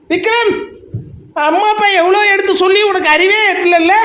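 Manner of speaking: a relative's sharp calling-out rebuke; scolding call. Retold or imitated. Family member scolding Vikram.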